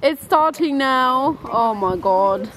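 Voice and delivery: ordinary delivery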